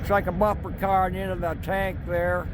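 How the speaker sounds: speaking up over the rumble of a train. Real, not acted. Filming a loud passing train.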